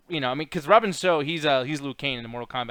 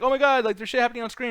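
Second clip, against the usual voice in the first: mock excitement; 8 semitones higher, faster than usual. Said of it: "when I'm like".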